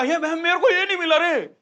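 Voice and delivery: high, loud whiny complaint; mock whining. Director crying that he got nothing, hammed-up whine. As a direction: whine like a grown man crying to his sister. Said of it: "वो इतना रोता".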